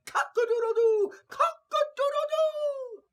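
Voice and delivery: very high, loud crowing; rooster crow. A narrator's reading. Rooster flying at the robber's head, loud high crow. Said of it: "flew wildly about the robber's head and cried".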